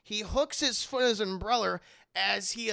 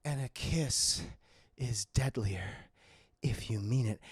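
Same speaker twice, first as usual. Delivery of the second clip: sultry Catwoman; 8 semitones lower than usual. Retold or imitated. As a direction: breathe it out low with long pauses. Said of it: "And she's like".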